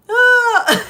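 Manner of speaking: high burst of laughter; laughing shriek. Real, not acted.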